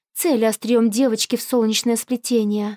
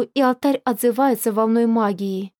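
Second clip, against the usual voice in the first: ordinary delivery, nothing marked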